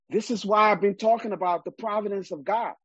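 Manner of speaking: ordinary talk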